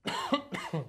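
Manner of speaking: sharp dry cough from a parched throat. Real, not acted. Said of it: "my throat is so dry".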